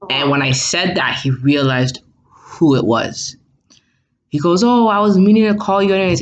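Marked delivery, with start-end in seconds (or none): 4.6-6.2 high, quick mock excuse; imitating evasive dad. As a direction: rattle off a retold excuse. Retold dad's hurried excuse.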